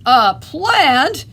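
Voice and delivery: loud, high, outraged question; horrified yell. A narrator's reading. Tyrannosaurus mom hearing baby prefers plants, horrified yell. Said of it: "yelled Mom in horror".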